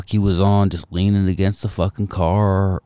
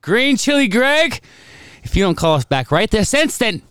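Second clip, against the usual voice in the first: much higher, slowed mock scolding; mock stern summons; 13 semitones higher than usual. Summoning the friend who won't call back, mock stern. Mock threatening the missing caller.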